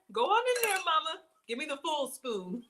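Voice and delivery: hungry customer teasing the lunch lady; pushier cafeteria plea. Retold or imitated. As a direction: beg the server for a full spoon, playful. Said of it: "you're like".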